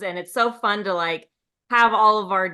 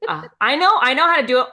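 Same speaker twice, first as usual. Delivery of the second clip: ordinary talk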